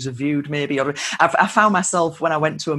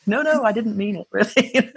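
higher, laughing plea that it was a joke; laughing protest; 6 semitones higher than usual. Retold or imitated. To Americans who took her sarcasm literally, laughing protest. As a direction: laugh through a quick denial. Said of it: "I'm like".

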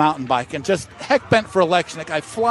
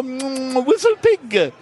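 high, hammed-up French relish; exaggerated French accent, pitched up after an appreciative hum; 8 semitones higher, much slower than usual. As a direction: savor it like a mock Frenchman. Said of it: "makes this snappy noise ... like a kiss".

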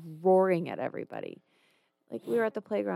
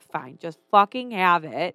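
slow, louder fed-up surrender; exasperated giving in; 9 dB louder, slower than usual. Retold or imitated. Mom retells giving the toddler her pacifier. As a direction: throw up your hands and give in. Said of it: "And I was like".

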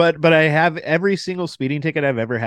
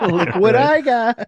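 high, slow, laughing brag; mock thrilled high voice; giddy show-off; 10 semitones higher, 4 dB louder, slower than usual. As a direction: show off the royal letter, giggling. Waving royal letter, put-on voice.

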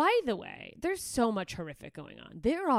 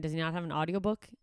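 ordinary talk

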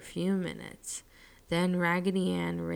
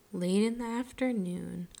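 plain reading voice

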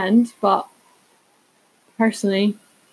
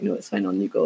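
man on the canal; 13 semitones lower, 5 dB quieter, faster than usual. Retold or imitated. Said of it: "And then eventually he was like".

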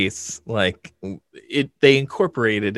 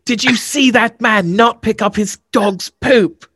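put-on high, posh English child; a posh London kid in a English accent; 11 semitones higher, 4 dB louder than usual. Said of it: "pretty good English accent impression".

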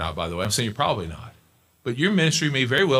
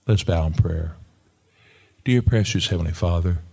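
closing prayer; 4 dB louder than usual. Acted. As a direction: pray over the congregation.

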